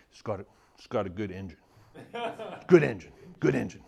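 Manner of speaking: lightly voiced dad, terse and matter-of-fact; a hint of a stubborn dad's voice; dad impression. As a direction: imitate Dad's set catchphrase, lightly put on. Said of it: "Talks like that".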